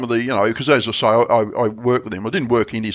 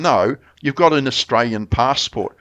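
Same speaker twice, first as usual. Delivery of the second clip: usual speaking voice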